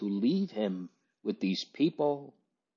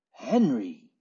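faint anguished cry; 3 semitones higher than usual. A narrator's reading. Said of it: "The anguish of that faint cry".

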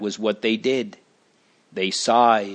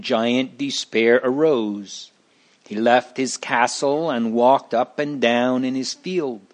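ordinary delivery

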